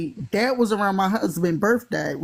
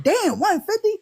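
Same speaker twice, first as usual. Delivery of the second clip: shocked; 10 semitones higher than usual. Retold or imitated. He's shocked at the bill.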